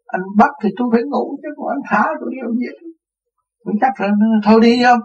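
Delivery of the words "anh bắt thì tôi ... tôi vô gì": high, mock indignant comeback; retelling a sassy answer to the police; cheeky retort. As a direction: toss it back at the policeman, amused.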